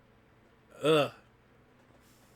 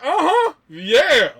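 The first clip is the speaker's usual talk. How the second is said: very high, loud, stretched-out cheer; mock gleeful agreement; 12 dB louder, much slower than usual. Brother cheering while everybody else cries, imitated high, slow glee. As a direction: draw it out in hammed-up delight.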